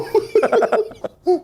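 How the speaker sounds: high-pitched burst of laughter. Real, not acted. Laughs at own story. (tertawa nyaring tinggi)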